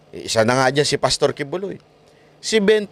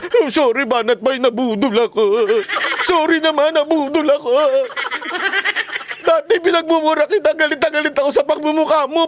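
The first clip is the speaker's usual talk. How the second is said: high, laughing mock apology in a put-on voice; whiny mock sorry, pitched high and giggling; mocking turncoat voter; 9 semitones higher than usual. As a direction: ham up a sheepish voter begging forgiveness. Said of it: "maniniwala na kayo na nabudol siya".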